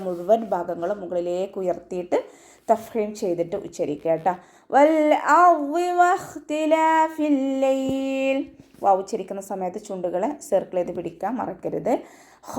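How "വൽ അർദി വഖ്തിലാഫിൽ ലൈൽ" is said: slow, high melodic tajweed chant; chanted Quranic verse, drawn out; Quran recitation. Shown as a demonstration. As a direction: stretch each syllable in a ringing recitation.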